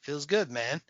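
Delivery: soft, content delivery; soft easy Pepe line; mellow catchphrase. Retold or imitated. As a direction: say it soft and satisfied. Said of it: "And he's like".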